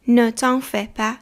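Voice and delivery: Ne t'en fais pas drawn out syllable by syllable; slow, careful repeat. Shown as a demonstration. Teacher to learners, slow model repeat. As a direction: say "Ne t'en fais pas" slowly so they can copy. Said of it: "teacher repeats slowly for learners".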